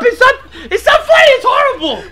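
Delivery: high, loud exasperated protest; outraged yell with a laugh in it; indignant outburst. Real, not acted. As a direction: shout down someone who is laughing. Co-host protests over the laughter.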